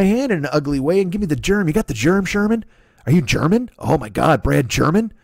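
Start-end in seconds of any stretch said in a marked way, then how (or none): none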